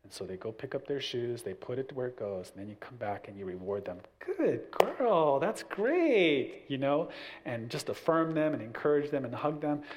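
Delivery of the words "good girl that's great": high, bright praising; cheerful praise. Retold or imitated. Dad rewarding his daughter, higher cheerful praise. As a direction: praise her like she just won.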